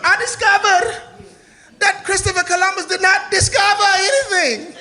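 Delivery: high, excited mock discovery; posh scientist voice. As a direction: announce it like a proud scientist. Mocking scientist voice returns.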